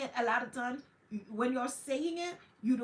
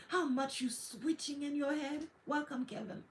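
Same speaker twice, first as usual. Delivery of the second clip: ordinary talk, nothing marked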